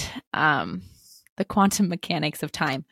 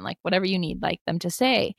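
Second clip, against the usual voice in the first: ordinary talk, nothing marked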